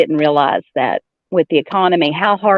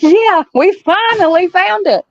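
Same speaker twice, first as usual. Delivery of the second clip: mock triumphant; 13 semitones higher than usual. Telling mom the chicken salad was found, hammed-up triumph. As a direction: sing it out like a big victory. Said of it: "And I'm like".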